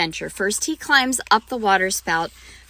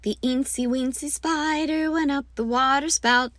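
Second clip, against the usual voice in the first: lightly higher, small eensy spider voice; tiny spider voice; 5 semitones higher than usual. Shown as a demonstration. Teacher demoing the smallest spider to her class, lightly small. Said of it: "make the spider biggerer and biggerer".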